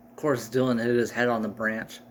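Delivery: ordinary talk, nothing marked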